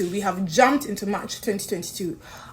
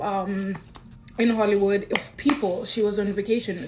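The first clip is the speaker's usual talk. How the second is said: ordinary delivery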